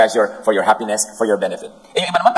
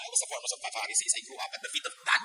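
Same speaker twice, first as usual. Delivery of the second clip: quick, hushed mock boast of young Paul; 16 dB quieter, faster than usual. Preacher mimics young Paul boasting of his Pharisee past, hushed and quick. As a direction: rattle it off like a proud Pharisee bragging softly. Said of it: "Pinagmamalaki pa nga niya".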